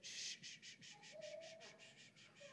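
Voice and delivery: put-on soft whispered shh to a scared child; gentle whispered shushing; soothing shush. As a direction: hush a child back to sleep. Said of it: "all she does is say".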